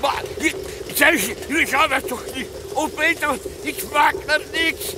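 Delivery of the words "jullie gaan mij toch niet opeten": ordinary delivery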